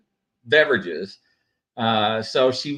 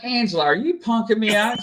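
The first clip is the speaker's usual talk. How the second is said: mock suspicious; 9 semitones higher, faster than usual. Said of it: "I'm like".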